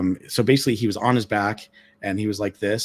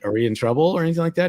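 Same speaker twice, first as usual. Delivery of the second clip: nervous worry; 8 semitones higher, much faster than usual. Retold or imitated. Staff worried about being caught.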